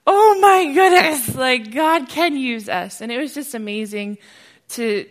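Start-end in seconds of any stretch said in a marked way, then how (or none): 0.1-3.0 much higher and louder, slow; joyful scream. Retold or imitated. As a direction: shout it to the rafters. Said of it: "I screamed at the top of my lungs".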